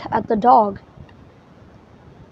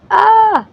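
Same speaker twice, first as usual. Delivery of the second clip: high, loud yelp of surprise; startled cry; 9 semitones higher, 6 dB louder than usual. Acted. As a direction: yelp as the ball strikes. Hit by balls.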